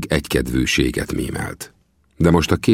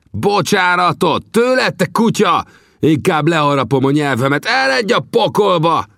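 much higher, loud furious roar; 14 semitones higher, 4 dB louder than usual. A narrator's reading. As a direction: bellow it in outrage. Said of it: "ordította".

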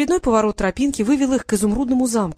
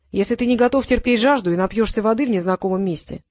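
plain reading voice

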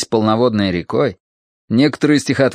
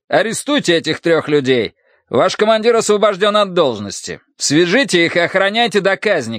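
raised, high-pitched orders to troops; imperious public command; 6 semitones higher than usual. Acted. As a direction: issue commands to the soldiers, voice pitched up. Minister publicly orders soldiers to arrest men.

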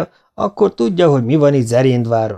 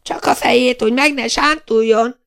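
put-on much higher squeal of an old lady; shrill old woman. A narrator's reading. Vilma néni squeals advice at the brawlers, old lady's high squeal. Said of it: "sívította Szivornyák Vilma néni".

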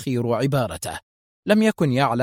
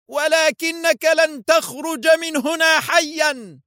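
slow, very high furious shout; angry outburst; slower than usual. A narrator's reading. Foster bursts out at Adham, a slow furious high shout. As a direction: explode with rage, dragging each word. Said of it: "ثم تفجر غضبه دفعة واحدة".

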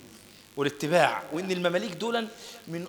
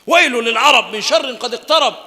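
high, loud, ringing hadith recital; preaching at full force; 7 semitones higher, 16 dB louder than usual. Acted. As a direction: proclaim it like a sermon peak.

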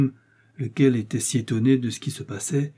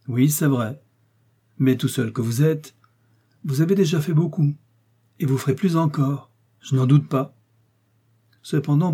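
raised, louder voice of a commanding cardinal; authoritative cardinal voice, pitched up; 3 semitones higher, 4 dB louder than usual. Acted. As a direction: speak down to the young man as the cardinal. Cardinal continues speaking.

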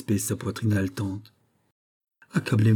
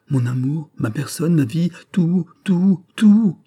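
builds from raised to a high, loud cry on each tout; rising passionate offer; 7 semitones higher, 5 dB louder, slower than usual. Acted. Marquise offering Fouquet her whole life, building to a high loud cry. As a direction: climb higher with every tout, giving everything.

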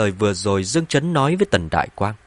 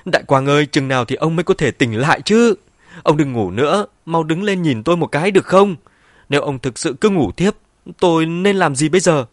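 high, loud lamenting plea; raised, distraught bedside lament; tearful wailing; 6 semitones higher, 5 dB louder than usual. A narrator's reading. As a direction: wail and plead as if crying. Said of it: "khóc nói".

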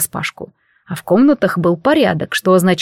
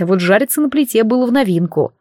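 usual speaking voice

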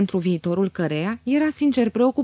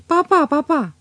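ashamed daughter gently scolding her father, higher and louder; gentle reproach; 7 semitones higher, 4 dB louder than usual. A narrator's reading. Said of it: "zise aceasta bătrânului rușinată, dar cu glas de blând reproș".

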